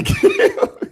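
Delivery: amused, name breaking into laughter; real chuckle on the name; laughing. Real, not acted. Joking about looking like [name].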